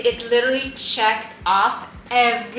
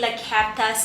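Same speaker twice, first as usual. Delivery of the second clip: ordinary talk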